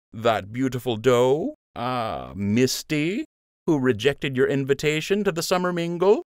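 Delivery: cheeky loyal sidekick; fond teasing friend. A narrator's reading. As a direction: rib him like an old pal. Lund voiced by narrator.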